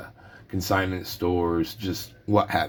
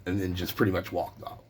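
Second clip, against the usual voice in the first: normal talking voice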